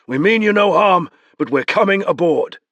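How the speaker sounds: firm call. Acted. Beron calling up to boat.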